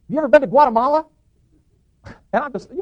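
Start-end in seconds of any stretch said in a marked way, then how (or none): 0.1-1.1 high, loud shouted question; raised, carrying voice; calling across store. Acted. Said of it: "my brother from across the store".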